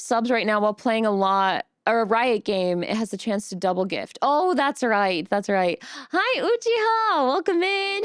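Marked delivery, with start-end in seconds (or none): 6.1-8.0 bright high greeting. Real, not acted. Greeting a chatter by name.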